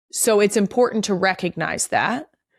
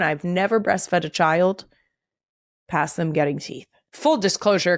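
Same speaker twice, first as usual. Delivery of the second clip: ordinary delivery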